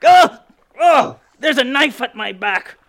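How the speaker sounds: high, loud yelps of alarm; startled yelps. Acted. Captain yelping at a stowaway's dagger, alarmed. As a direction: cry out as a knife pokes your back.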